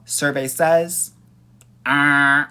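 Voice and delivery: slow, loud announcer "survey says"; game show host. Pretend.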